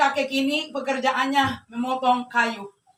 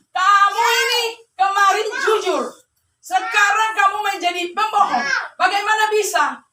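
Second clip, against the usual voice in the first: higher, louder acted rebuke; scolding genie; 8 semitones higher, 6 dB louder than usual. Genie scolding the old man for lying, mock stern. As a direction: accuse him in mock indignation.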